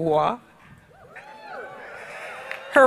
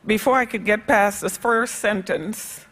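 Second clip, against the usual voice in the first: ordinary talk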